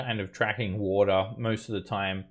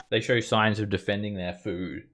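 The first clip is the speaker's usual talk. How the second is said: normal talking voice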